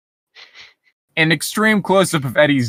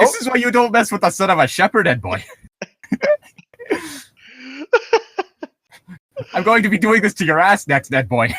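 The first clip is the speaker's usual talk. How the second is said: mock Rolf threat; 8 semitones higher, faster than usual. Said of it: "voicing Rolf threatening the Eds".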